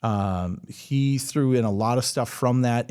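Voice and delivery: usual speaking voice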